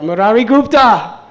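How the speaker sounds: high, loud joyful call. Retold or imitated. Nimai clapping and dancing, calling Murari loudly. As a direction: call him out while dancing. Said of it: "calling out".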